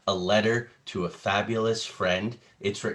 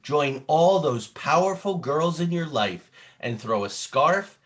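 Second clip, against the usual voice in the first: excited read-aloud, higher and louder at the party invitation; dramatic storybook reading; 6 semitones higher, 6 dB louder than usual. Acted. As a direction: sell the party to a young listener. Reading picture book aloud dramatically.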